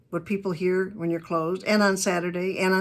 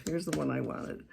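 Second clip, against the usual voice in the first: muttered to self; 4 semitones lower, 8 dB quieter than usual. Real, not acted. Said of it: "ah, here's the one I wanted".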